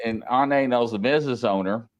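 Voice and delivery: ordinary talk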